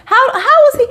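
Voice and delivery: high, incredulous How, how; outraged at the blame, voice jumping up; exasperated disbelief. Real, not acted.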